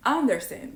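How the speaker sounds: "understand" punched loud and high on UN; stress on first syllable. Shown as a demonstration. Learner retelling a teacher's correction, first-syllable stress. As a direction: mistakenly hit the start of "understand". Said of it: "I used to stress the first syllable".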